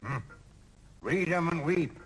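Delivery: ordinary delivery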